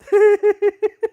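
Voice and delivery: loud, high burst of laughter; real loud laugh at his own joke. Real, not acted. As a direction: cackle loudly, high up. Laughing after own punchline.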